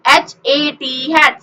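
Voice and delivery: एच ए टी spelled slowly letter by letter, then हैट blended; letter by letter for children; sounding out letters. Shown as a demonstration. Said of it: "सबका अपना अपना sound".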